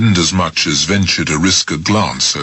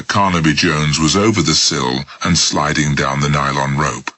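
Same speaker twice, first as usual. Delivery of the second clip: plain reading voice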